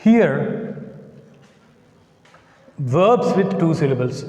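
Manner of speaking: ordinary talk, nothing marked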